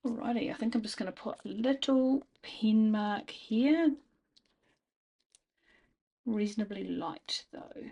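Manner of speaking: ordinary talk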